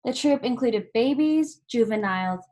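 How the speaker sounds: plain reading voice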